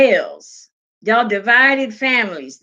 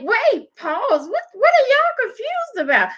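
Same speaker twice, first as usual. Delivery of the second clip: high, incredulous "what are y'all confused about"; a hammed-up double take, voice jumping up; mock baffled disbelief; 11 semitones higher than usual. Speaker retelling their reply to comments, mock baffled. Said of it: "So I was like".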